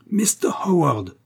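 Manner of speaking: high, drawn-out strained yell; hurlement. A narrator's reading. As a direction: call out the name slowly, high and hoarse. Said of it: "hurla James Smallscott". (cri aigu, étiré, voilé)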